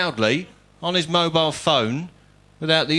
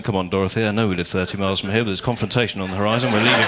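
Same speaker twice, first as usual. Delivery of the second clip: lower, fast fussy English commuter; a lightly voiced fleeing passenger, lower and fast; 7 semitones lower, faster than usual. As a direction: hurry Dorothy off the train, lower and quick.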